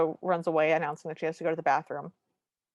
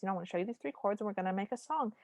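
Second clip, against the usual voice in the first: gentle; 8 dB quieter, faster than usual. Retold or imitated. Luke gently teaches chords.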